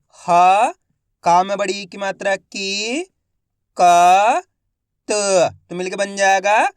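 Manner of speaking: each syllable said alone, loud and clipped, then the whole word drawn out slowly; slow Hindi reading drill, syllable by syllable; phonics sounding out. Shown as a demonstration. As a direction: spell it out syllable by syllable for a child, then blend it slowly. Teacher sounds out and blends words.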